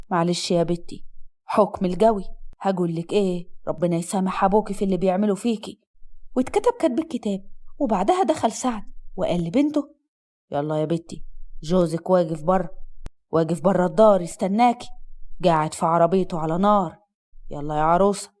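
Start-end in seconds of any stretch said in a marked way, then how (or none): 0.1-5.8 low-pitched mother soothing her daughter; resigned, consoling mother in a low voice. Acted. 10.5-18.3 low-pitched father hurrying his daughter along; father urging bride. Acted.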